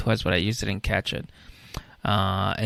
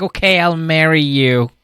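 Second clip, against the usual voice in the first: mock bride; 6 semitones higher, 7 dB louder than usual. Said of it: "she's like".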